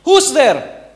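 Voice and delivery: high, loud "Who's there?" dropping at the end; loud master's challenge. Acted. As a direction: boom it through the door as the master. Master answers the knock. (amo na malakas na sumisigaw mula sa loob)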